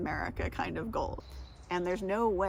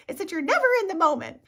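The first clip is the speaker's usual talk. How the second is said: high, loud, fast mock accusation; imitating her accusation; 10 semitones higher, 9 dB louder, faster than usual. Retelling what a friend told her, high loud mock accusation. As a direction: toss off her complaint, higher, louder and quicker. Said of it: "and she's like".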